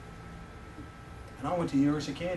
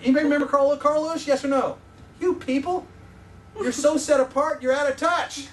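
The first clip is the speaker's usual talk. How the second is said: mock scolding; 9 semitones higher, 7 dB louder than usual. Real, not acted. Teasing the congregation loudly.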